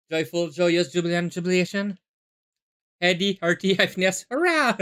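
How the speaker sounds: ecstatic. Acted. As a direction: burst with giddy joy. Ecstatic alliterative outburst.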